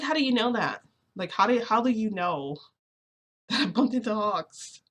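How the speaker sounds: high, loud disbelieving questions; retold shock. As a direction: act out being caught out, pitch and volume up. Retold shock at being found out.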